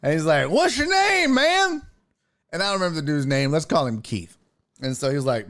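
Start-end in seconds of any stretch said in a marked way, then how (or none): 0.5-1.8 higher, loud-ish boozy greeting; drunk guy voice. Retold or imitated. Drunk guest asks the husband his name, higher and boozy. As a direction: play the overfriendly drunk.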